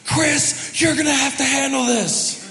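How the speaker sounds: imitating wife's yell. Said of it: "I just remember Beth yelling".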